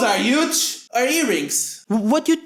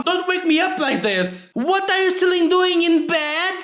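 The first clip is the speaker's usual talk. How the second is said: mom voice; 7 semitones higher than usual. Acted. Voicing mom in game.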